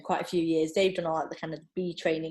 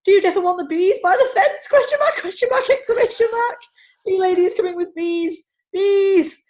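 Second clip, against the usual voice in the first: high, loud frantic text reading; 9 semitones higher, 9 dB louder than usual. Retold or imitated. Texting her husband mid-speech, frantic and high. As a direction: read the messages like you're in a panic. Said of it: "in sheer panic".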